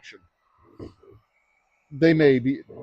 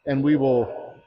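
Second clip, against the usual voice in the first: a half-mocking solemn oath, higher and slow; 5 semitones higher than usual. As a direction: recite the clerk's oath with light mock solemnity. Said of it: "our oath of office as election clerks".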